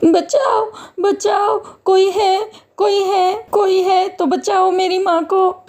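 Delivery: high-pitched urgent calls for rescue; crying for help. Acted. Daughter begs anyone to save her mother from fire, desperate cries. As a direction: call out desperately in a high child's voice. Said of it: "Vaishnavi मदद के लिए चिल्लाने लगी".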